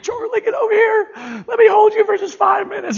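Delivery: high-pitched, loud weepy plea, hammed up; exaggerated crying-dad voice; mock tearful dad. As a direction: wail it like an over-emotional father. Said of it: "I'm just crying".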